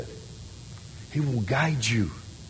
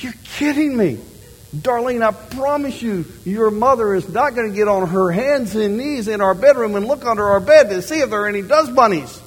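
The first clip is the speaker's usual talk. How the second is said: exasperated husband; 9 semitones higher, 4 dB louder than usual. Retold or imitated. Pastor arguing with wife.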